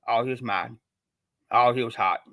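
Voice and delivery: ordinary talk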